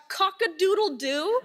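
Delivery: drawn-out mock crow, pitched high; imitated rooster shout. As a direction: crow it slowly like a retold shout. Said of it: "swings the door open full force and shouts".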